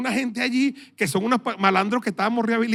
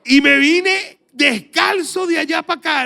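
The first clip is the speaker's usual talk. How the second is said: louder, higher, slow and emphatic; loud slow exclaim; 6 semitones higher, 5 dB louder than usual. Real, not acted. Pastor telling the congregation he walked home barefoot, loud and slow. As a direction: proclaim the barefoot walk home.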